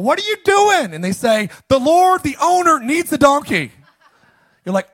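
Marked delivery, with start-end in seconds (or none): 0.0-0.9 high, loud, outraged yell at the thieves. Pretend. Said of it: "And you yell". 1.7-3.7 high, loud, overblown proclamation; mock proclamation. Strangers answer donkey owner.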